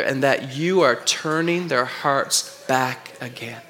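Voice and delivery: slow, soft shared reading; reading together. Real, not acted. Pastor reads the last line with the congregation, slow and soft. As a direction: read it slowly with the congregation. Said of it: "can we read this last part together".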